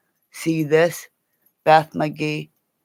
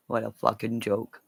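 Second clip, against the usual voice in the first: ordinary delivery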